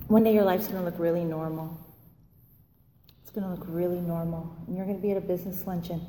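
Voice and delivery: low, soft, measured retold reassurance; calm sponsor. Sponsor telling a crying newcomer about her future, calm. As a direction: bring it right down, gently explaining.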